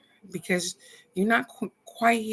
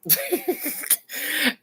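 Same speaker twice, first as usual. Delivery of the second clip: high, real burst of laughter; a real laugh that breaks out; 11 semitones higher than usual. Real, not acted. Laughs after son's remark.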